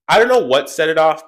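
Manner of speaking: ordinary talk, nothing marked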